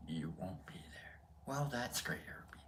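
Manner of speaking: trailing off into a whisper; dejected whisper. Pretend. Host giving up on Herbie, dejected whisper. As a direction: whisper it as a let-down aside.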